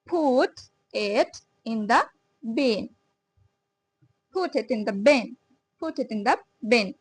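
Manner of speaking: very slow, word by word; slow drill. Shown as a demonstration. Teacher drilling a sentence for learners, very slow. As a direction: spell out each word slowly. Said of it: "তারপরে".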